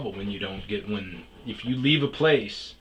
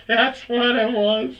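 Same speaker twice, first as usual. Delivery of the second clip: louder, excited realization; sudden recollection; 13 semitones higher, 7 dB louder, slower than usual. Real, not acted. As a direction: it just came back to him. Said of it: "oh, yes".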